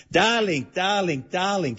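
put-on Hungarian accent. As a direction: imitate a famous star's Hungarian-accented endearment. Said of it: "in her famous Hungarian accent".

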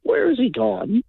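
high, drawn-out questioning; exaggerated slow puzzlement; mock puzzled wondering. Imagined listeners asking after him.

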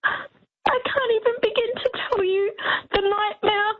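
tearful, voice cracking high as she speaks; real tears breaking through her words; crying. Real, not acted. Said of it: "I'm so emotional".

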